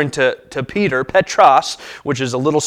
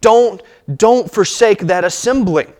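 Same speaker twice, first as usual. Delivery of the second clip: commanding; 5 semitones higher than usual. Retold or imitated. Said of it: "He's commanding".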